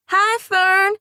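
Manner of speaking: put-on high, bright sing-song child's greeting; sing-song little girl. A narrator's reading. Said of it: "in a sing-song voice".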